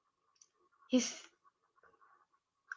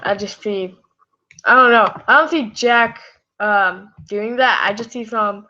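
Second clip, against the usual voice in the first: usual speaking voice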